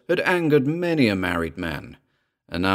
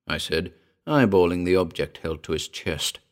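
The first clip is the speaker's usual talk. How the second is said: ordinary delivery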